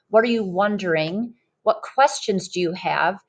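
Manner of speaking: ordinary delivery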